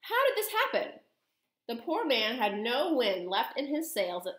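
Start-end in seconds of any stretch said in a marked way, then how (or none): 0.0-1.0 very high, bewildered question; flustered shock. A narrator's reading. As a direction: ask it aghast, pitched right up. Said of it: "stammered Mister Jenkins again".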